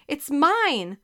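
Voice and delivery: high, loud claim of the puck; calling out. A narrator's reading. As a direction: shout to claim the shot. Player calls for the puck.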